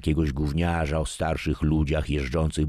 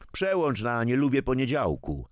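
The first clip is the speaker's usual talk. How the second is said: higher, calling across the room; 9 semitones higher than usual. A narrator's reading. Grandfather calls to son-in-law over the news, calling out. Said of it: "zawołał Edmund do zięcia".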